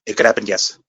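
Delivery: put-on Mexican accent on the tag line. Finishing the Mexican accent impression. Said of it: "go way south".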